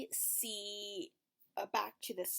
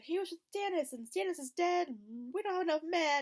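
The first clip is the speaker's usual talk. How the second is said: mock Sansa complaint; 9 semitones higher, 4 dB louder than usual. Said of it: "And she's like".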